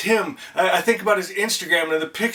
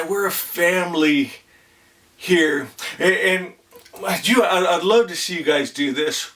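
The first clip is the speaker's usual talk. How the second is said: ordinary talk, nothing marked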